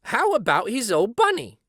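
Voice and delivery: high, bright nanny voice asking lightly; a fussy nanny pointing out the toy; Nana asking. A narrator's reading. Nana asking the doctor about the old bunny. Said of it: "she asked".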